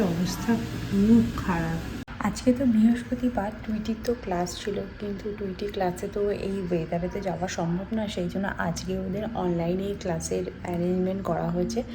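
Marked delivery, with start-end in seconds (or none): 2.2-11.8 low, soft voice, keeping it down; hushed talk. Real, not acted. Family working and studying in the next rooms, hushed low talk. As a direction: speak quietly so nobody next door is disturbed. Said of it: "ওই জন্য আমি একটু আস্তে আস্তে কথা বলছি".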